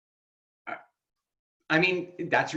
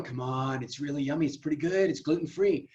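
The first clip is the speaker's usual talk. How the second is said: soft, much quieter sweet coaxing; coaxing tempter; 4 dB quieter than usual. Retold or imitated. Ariel imitated coaxing guests, softly tempting. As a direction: lure them in gently. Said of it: "She's the first one going".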